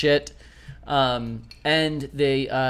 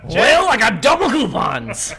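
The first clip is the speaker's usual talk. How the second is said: mock shopper voice; 9 semitones higher, 5 dB louder than usual.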